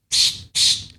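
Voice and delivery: soft breathy shh sounds; imitated shushing. Retelling his son pacing off the strike zone, soft shushing noises. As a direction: hush it twice like marking out a line.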